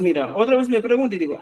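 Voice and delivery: normal talking voice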